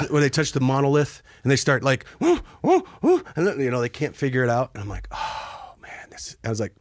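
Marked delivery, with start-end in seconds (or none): 2.2-3.2 very high, loud ape hoots; mock monkey hoots from 2001; ape hooting. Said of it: "Space Odyssey". 5.1-6.4 whisper. Retold or imitated. Inner thought, soldiers can't open door.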